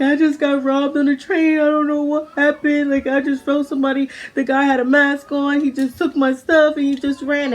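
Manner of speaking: high, loud, distraught whine; imitated panicked, wailing report; retold hysterical. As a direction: blurt it out as if crying to police. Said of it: "I'm super duper hysterical".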